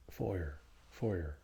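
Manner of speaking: "fire" as West Country "foyer", R sounded; West Country pronunciation. Shown as a demonstration. Contrasting with American, West Country "fire" like "foyer". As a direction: say "fire" with an oy vowel and R. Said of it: "Or the West Country fire".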